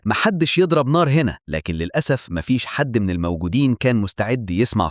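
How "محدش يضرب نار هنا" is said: higher-pitched cry, no real volume; understated yelled warning in narration; mild narrated yell. A narrator's reading. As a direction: call it out like a restrained shout. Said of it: "المهندس صرخ وقال".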